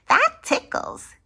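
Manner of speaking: high giggle then squeaky line; high ticklish cartoon laugh; giggling Larry.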